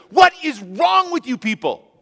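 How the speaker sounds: a furious preacher scolding his flock; outraged preacher. Acted. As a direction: synagogue preacher yelling at the people over the healing. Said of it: "the preacher gets up there and says".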